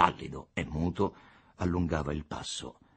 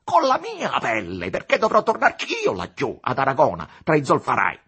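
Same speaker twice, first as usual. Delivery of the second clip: loud, high-pitched mounting tirade; inflamed with anger; 10 semitones higher, 11 dB louder than usual. A narrator's reading. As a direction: rage on, piling up grievances. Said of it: "infiammato d'ira". (tirata furiosa, infiammata d'ira)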